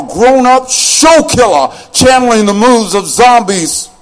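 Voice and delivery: rhythmic, rising poetry slam delivery over a beat; charged spoken word, riding the music; spoken word performance. Acted. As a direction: perform it like a slam poet owning the stage.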